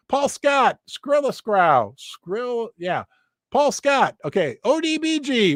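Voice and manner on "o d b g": ordinary talk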